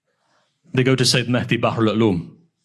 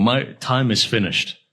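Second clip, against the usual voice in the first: ordinary talk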